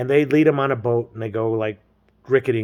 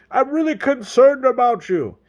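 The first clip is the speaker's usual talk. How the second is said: high, put-on earnest call; mock concern; 11 semitones higher, 4 dB louder than usual. [name] calls to Terry at the beach, mock concern. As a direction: overplay the worry in a raised voice.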